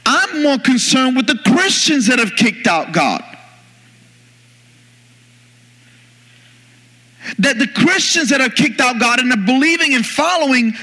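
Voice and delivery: high-pitched, emphatic sermon delivery; impassioned preaching. Real, not acted. Preacher urging believers to open their eyes, impassioned preaching. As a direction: preach it urgently, pitched well up.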